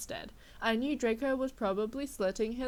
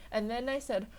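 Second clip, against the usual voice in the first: usual speaking voice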